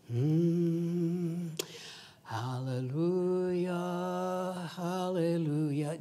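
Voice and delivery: slow, drawn-out hallelujah; slow detached hallelujah that ignores the person; dreamy hallelujah. Acted. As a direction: hum it off in a distant, dreamy way. Mocking an oblivious churchgoer.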